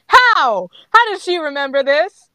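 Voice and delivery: loud, high-pitched disbelief; real exasperated bewilderment; incredulous outburst. Real, not acted. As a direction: blurt it out, baffled by the plot. Reader baffled by the story.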